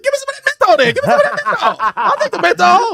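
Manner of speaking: high, loud comic clamouring; eager demand. Retold or imitated. Actor begging crew for crying aid, eager clamouring. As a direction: holler for it, comically desperate.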